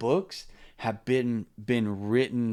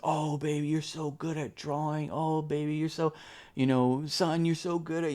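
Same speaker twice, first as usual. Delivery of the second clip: put-on higher, sing-song praise for a child; gushing parent praise; 6 semitones higher than usual. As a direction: coo over the kid's drawing. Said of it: "we cheer on our kids".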